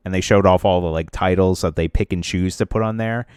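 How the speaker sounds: ordinary delivery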